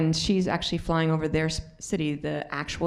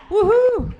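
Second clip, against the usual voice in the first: a high, loud whoop of joy; excited cheer; 11 dB louder than usual. Real, not acted. Celebrating the team name with a loud whoop.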